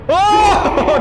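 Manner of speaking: high, loud "oh"; startled yell. Real, not acted. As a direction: yelp as it suddenly comes fast. Startled as enemy speeds up.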